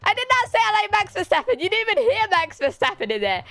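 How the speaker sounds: high, fast, incredulous denial; rapid, high-pitched mock outrage; playful indignant protest. Real, not acted. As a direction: protest the silly guess at speed. Protests chat's absurd guesses.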